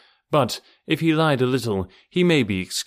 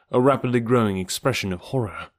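ordinary delivery, nothing marked

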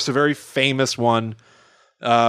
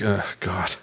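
muttered dismay; 6 semitones lower, 5 dB quieter than usual. Retold or imitated. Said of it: "I'm like".

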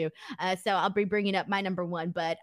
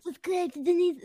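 attempted Tyson; 8 semitones higher than usual. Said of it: "how can I do it?".

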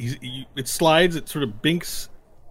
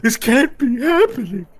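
high, loud, hammed-up wail; squealing tearful denial; crying; 11 semitones higher, 7 dB louder than usual. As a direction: wail your disbelief through mock sobs. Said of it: "Megan's crying".

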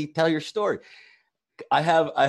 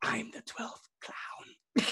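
whisper; 10 semitones higher, 11 dB quieter, much slower than usual. Pretend. Whispered punchline of mashup bit.

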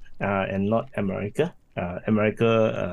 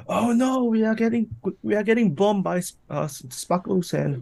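high panicked squeal; mock panicked scream; 11 semitones higher than usual.

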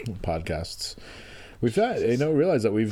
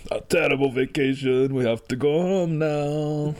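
a touch of a Quebecois tourist whining; Quebecois accent; 3 semitones higher than usual. Pretend. Repeating the Quebecois vacation line, mock accent. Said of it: "that's my Quebecois accent".

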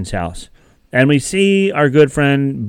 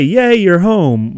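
slow, lightly excited greeting; talking puppy greeting its owner, mildly happy; a touch of puppy joy; 5 semitones higher, 3 dB louder, slower than usual. Pretend. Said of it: "now they can actually say".

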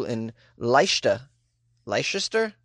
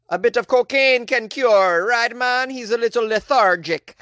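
put-on higher, louder Jamaican accent; 8 semitones higher, 5 dB louder than usual. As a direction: slip back into a Jamaican accent. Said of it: "Jamaican accent".

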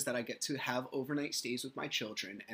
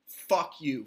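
a punched, louder "Fuck you"; louder curse; 3 semitones higher, 8 dB louder than usual. Real, not acted. Cursing the ex.

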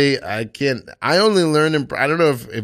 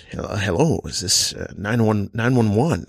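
Johnny Carson. Retold or imitated. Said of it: "this is Johnny Carson making the nine one one call".

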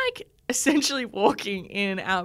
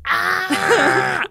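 high, loud scream of pain; imitating a labor-pain scream; 11 semitones higher, 9 dB louder than usual. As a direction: cry out sharply in labor pain.

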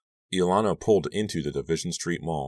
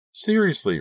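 high-pitched, louder insistence; insistent; 5 dB louder than usual. A narrator's reading. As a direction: press the point, voice jumping up high. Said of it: "Rachel insisted".

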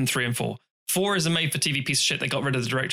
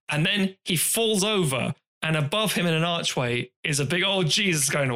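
ordinary talk

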